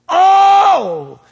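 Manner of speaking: high, loud cry of wonder; ecstatic shout. Real, not acted. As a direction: burst out in awe. Exclamation shout.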